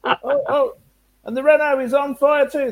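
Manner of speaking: high-pitched excited commentary; excited race commentator. Retold or imitated. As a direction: call it like a breathless commentator. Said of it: "start on our Murrayisms".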